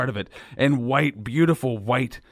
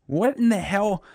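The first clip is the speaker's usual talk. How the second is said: incredulous; 9 semitones higher than usual. Real, not acted. Reacting to weird sauce.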